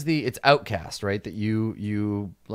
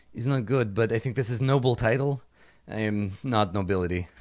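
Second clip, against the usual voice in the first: put-on Soyuzkin accent. GM voices Oksana with broken grammar.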